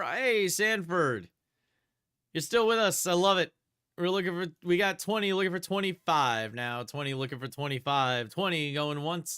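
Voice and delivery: brisk auction calling, bids rising; auctioneer call. Acted.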